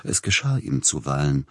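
plain reading voice

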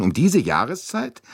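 high-pitched, incredulous question; startled interjection. A narrator's reading. Director amazed the train is full in this season, incredulous. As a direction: break in, astonished. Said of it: "rief Monsieur Bouc dazwischen".

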